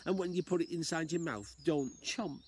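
put-on a wise old lady advising, sing-song. Old lady tells the man to swallow a spider, high voice. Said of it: "my best old lady impression".

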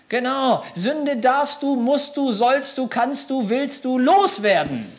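loud, high, slow emphatic preaching; rising preacher's fervour; forceful preaching. Real, not acted. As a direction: drive it home to the congregation.

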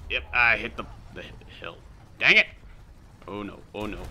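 ordinary delivery

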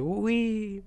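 high little squeal; imagine flipping round in a hamster wheel; playful drawn-out glee; mock whee. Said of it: "I would just be, like, flipping around. It's like".

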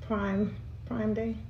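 speaking between sips; mouth on a straw. Real, not acted. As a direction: lips around drinking straw. Seen 1: Straw at lips while sipping; stays just below the lips while she talks.

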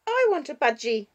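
put-on insistent child, high and loud; dangerous note. A narrator's reading. Six-year-old demanding a budgie, dangerous note in her voice. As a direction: demand it with a warning edge. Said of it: "with a dangerous note in her voice".

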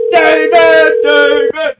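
loud, high shouts of the name; calling out. Real, not acted. Prankster calling for agent David, shouting. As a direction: holler the name repeatedly.

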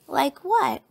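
put-on high, loud child's question; lightly voiced little cub; challenging cub. A narrator's reading.

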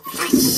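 "Flush" breathed as a whisper. Pretend. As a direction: whisper "Flush" like the water rushing. Flushing again.

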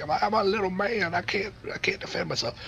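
high whiny helpless voice; whiny little man. Pretend.

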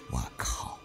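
plain reading voice

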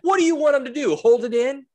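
high, loud outraged defence; mock indignant rant. Dad telling off disgusted lady in Target, mock indignant. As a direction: tell her off, hammed-up and pitched high.

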